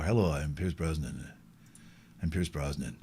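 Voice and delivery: low, soft, suave Brosnan voice; deep Brosnan voice. Pretend. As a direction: drop low and smooth like Brosnan.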